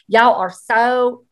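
drawn-out mock Southern drawl; imitated Southern accent. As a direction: tease her with a slow Southern imitation. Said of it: "that Southern accent and said".

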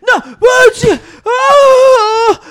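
high, loud mock outburst; imitating emotional shout. Emotional brother yelling at the game, loud and high. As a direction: blow up like an emotional wreck.

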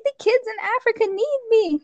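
high-pitched imitation of a crying girl; mock tearful plea. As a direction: plead as if crying over a missed flight. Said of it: "And she's crying".

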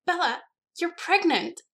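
high, loud, drawn-out squeal; slow, soaring shriek of excitement; excited squeal. A narrator's reading. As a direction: squeal the big news with delight. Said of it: "Alice squealed so loudly".

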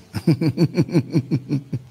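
long run of quick chuckles; teasing chuckle. Real, not acted. Host teases viewers about what is coming, long knowing chuckle.

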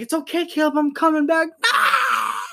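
put-on high, wailing child cry over mom's words; hammed-up toddler bawling; mock crying wail. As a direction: howl like a sobbing toddler. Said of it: "And she'd be like".

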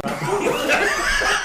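laughing. Retold or imitated. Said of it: "and his words were".

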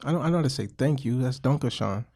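usual speaking voice

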